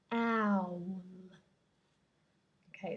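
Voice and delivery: ordinary delivery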